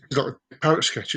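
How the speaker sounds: ordinary delivery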